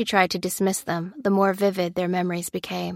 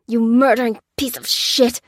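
louder strained snarl; grunted curse; 4 semitones higher, 6 dB louder than usual. A narrator's reading. Lopez pinning Lucy down, strained angry curse. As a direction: force it out mid-struggle. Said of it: "grunted Lopez".

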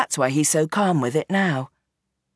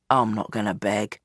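lower, weary father pleading his case; old gangster Bernie; 8 semitones lower, 4 dB quieter than usual. Acted. Gangster father explains himself to his daughter, low and weary. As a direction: sound like a tired old crime boss.

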